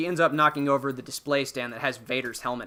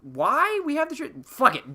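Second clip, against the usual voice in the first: mock baffled, much higher; confused; 11 semitones higher, 3 dB louder, slower than usual. Podcaster voicing the crew baffled by Rey, high and puzzled. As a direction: pitch up in puzzled disbelief, then give up. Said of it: "they're like".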